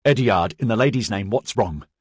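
ordinary delivery, nothing marked

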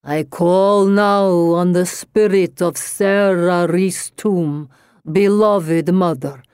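slow, booming séance summons. A narrator's reading. Medium calls on a dead mother's spirit, slow resonant incantation. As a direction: intone it grandly, stretching the words. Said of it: "her voice into a lower register".

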